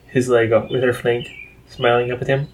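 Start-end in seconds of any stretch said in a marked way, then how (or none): none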